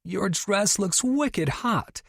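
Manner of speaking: high and slow, savoring each word; enthusiastic gush. Acted. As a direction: gush over the dress again. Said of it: "regaining her enthusiasm".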